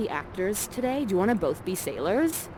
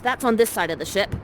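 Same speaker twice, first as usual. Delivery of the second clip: usual speaking voice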